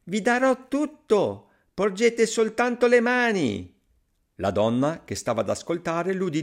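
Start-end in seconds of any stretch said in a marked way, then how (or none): 0.1-3.8 very high, slow, ringing shout; piping, drawn-out yell. A narrator's reading. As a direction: yell it as hard as you can. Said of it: "gridò più forte che poté".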